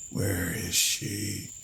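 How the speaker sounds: very slow, soft, husky creature voice; husky mutter. A narrator's reading. Cadaver gripping Lloyd, slow husky mutter. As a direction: drawl it out like a ghoul. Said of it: "The thing muttered huskily".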